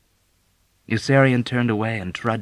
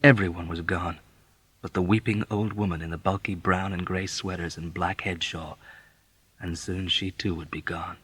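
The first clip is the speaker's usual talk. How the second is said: plain reading voice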